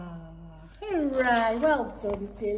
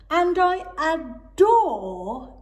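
slow, louder, higher adore; adoring owl; 6 semitones higher, 8 dB louder, much slower than usual. Acted. Owl gushing about her pom-pom, slow. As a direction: gush with fondness.

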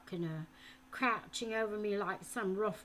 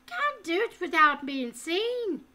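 put-on higher, louder anxious boy; frightened protest; 9 semitones higher, 10 dB louder than usual. A narrator's reading. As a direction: protest in a panic.

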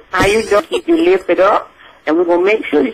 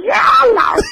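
joyful shout; 14 semitones higher than usual. Real, not acted. Joyful exclaim about the show.

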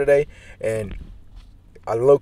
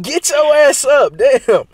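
loud; 11 semitones higher, 4 dB louder, much slower than usual. Real, not acted. Yells at her to get up.

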